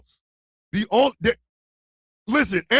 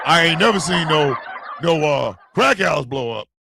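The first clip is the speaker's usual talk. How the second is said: emphatic restatement; 4 semitones lower than usual. Real, not acted. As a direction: say it out firmly so everyone hears. Repeats the aside out loud.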